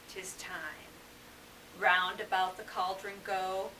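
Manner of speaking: low, hushed recital of an eerie verse; poetry reading. Acted. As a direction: chant the witches' rhyme softly, line by line. Reads witches' song from Macbeth.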